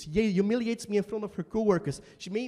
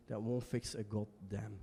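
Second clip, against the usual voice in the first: much softer, slower; bitter trailing off; 8 semitones lower, 11 dB quieter, slower than usual. Acted. Ending the speech to the teacher, bitter and soft. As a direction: let the words die out.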